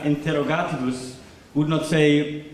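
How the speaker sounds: ordinary talk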